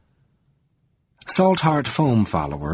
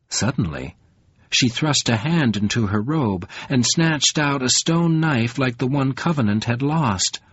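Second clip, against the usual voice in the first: ordinary delivery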